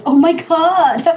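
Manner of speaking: surprised. Real, not acted. Asked to speak with a British accent, loud surprise. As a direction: react as if the request is too much.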